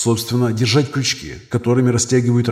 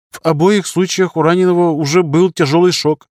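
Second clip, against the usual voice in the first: high-pitched junior doctor making excuses; defensive young surgeon; 7 semitones higher, 4 dB louder than usual. Acted. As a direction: justify yourself in a raised, youthful pitch. Young surgeon excuses his results. (молодой хирург, высоким голосом, оправдываясь)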